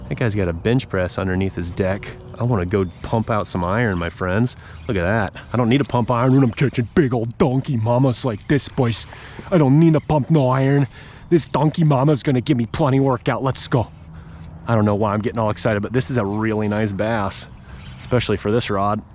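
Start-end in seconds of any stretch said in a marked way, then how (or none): 5.5-13.9 tough guy bragging. Acted. Hammed-up bragging to viewers.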